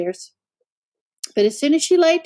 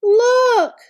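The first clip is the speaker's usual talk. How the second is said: cub's cry; 12 semitones higher, 5 dB louder than usual. A narrator's reading. Cub spots the broken chair.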